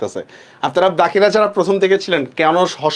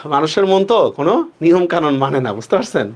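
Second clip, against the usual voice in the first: usual speaking voice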